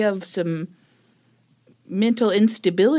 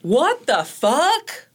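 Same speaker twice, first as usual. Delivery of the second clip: slow, louder, higher disbelief; appalled; 5 semitones higher, 4 dB louder, much slower than usual. Real, not acted. Co-host reacting to the killer's words, in slow disbelief. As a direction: drag it out, horrified.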